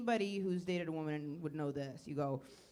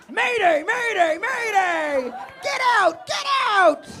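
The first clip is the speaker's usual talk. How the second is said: high, very loud drawn-out distress call; panicked mayday shout; 11 semitones higher, 13 dB louder, slower than usual. Acted. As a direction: scream the alarm, climbing higher. Acted emergency as apology fails.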